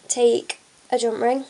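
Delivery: normal talking voice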